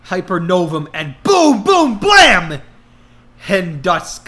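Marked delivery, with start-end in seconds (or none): 1.2-2.6 high loud blast words; explosive. A narrator's reading. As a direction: shout the boom.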